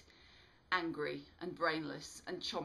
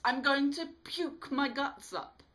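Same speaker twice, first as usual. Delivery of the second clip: much higher, louder nauseated cry; revolted shout; 8 semitones higher, 9 dB louder than usual. A narrator's reading. Alice shouting she'll be sick, revolted. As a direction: wail it like you're about to gag.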